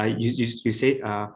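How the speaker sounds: normal talking voice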